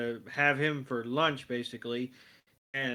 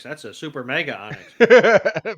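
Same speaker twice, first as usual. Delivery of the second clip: booming mock announcer voice, much louder; big hype announcement; 12 dB louder than usual. Hyping the giant Onix to a friend, loud mock announcer. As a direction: blow it up like a TV hype line.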